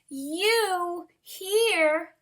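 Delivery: high, surprised gasp; breathless astonishment; mild mock gasp. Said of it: "gasped Mister Bird".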